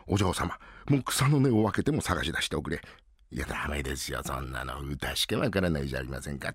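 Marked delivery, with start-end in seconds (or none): 3.3-6.5 much lower, softer sulky refusal; glum refusal. Acted. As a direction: back away quietly, daunted. Kuma refuses, voice drops.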